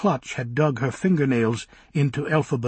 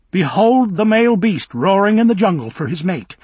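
grand mock announcement, higher and louder; mocking Elphaba; 8 semitones higher, 8 dB louder than usual. Elphaba mocking Bock's courtship, hammed-up and louder. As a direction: lampoon him like a nature guide.

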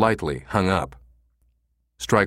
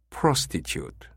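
slow, softer, with distaste; distasteful; 6 dB quieter, slower than usual. A narrator's reading. Manager names what the caller wants, distaste. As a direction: let the word slide out like something vile. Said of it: "slid off his tongue like a vile disease".

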